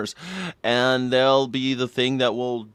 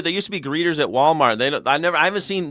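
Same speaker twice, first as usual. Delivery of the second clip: ordinary talk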